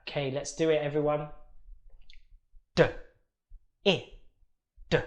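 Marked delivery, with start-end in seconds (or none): 2.8-5.0 each sound D, I, D said separately and slowly. Shown as a demonstration. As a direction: segment D I D, one sound at a time. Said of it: "let's sound it out slowly".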